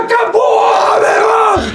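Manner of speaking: very high, excited mock scream; re-enacted victory shout. Player reliving the final whistle, high mock victory shout. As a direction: scream it high, as if celebrating on the pitch. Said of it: "eu só gritava assim".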